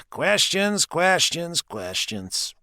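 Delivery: a hint of an unseen mocking voice; mysterious voice. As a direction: tease from the darkness. Unseen voice from the darkness.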